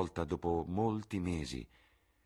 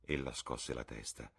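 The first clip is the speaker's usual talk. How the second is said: plain reading voice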